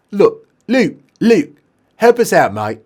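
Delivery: high, loud British lad calling out; pleading patient. Acted. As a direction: beg your mate for backup. Patient calls Luke to help.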